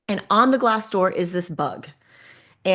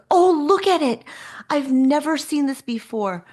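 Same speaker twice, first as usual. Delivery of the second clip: excited outburst, pitched up, easing off slightly; amazed delight; 5 semitones higher than usual. Retold or imitated. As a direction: imitate someone thrilled by a discovery. Retold man delighted by the bug.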